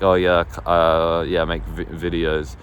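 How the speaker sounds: halting, low-pitched reluctant admission; hesitant. Retold or imitated. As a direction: stumble and hedge as if unsure of your own answer. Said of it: "I'm a bit hesitant to".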